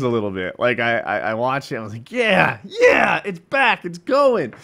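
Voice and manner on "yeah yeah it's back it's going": high, loud cheering; re-enacted excitement. Fan watching Game of Thrones return, shouted excitement. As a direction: cheer like a fan whose show has returned.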